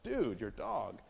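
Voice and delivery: hammed-up shocked stranger; startled exclamation. Shown as a demonstration. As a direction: gasp at the dog in mock alarm. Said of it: "that person to go like".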